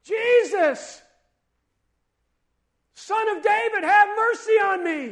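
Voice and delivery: full-force high shout for mercy; desperate acted yell; crying out loudly. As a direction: bellow the plea with all your might. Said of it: "He cried out even more loudly".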